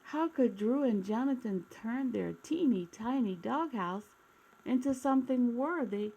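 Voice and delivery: gentle read-aloud storytelling voice; storybook narration. A narrator's reading. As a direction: read it like a bedtime picture book for kids. Reading a picture book aloud.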